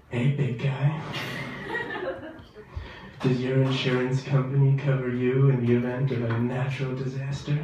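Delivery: lightly done sultry insurance pitch; sexy ad voice. Retold or imitated. Comic mocking a seductive State Farm ad. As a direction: purr the sales line softly.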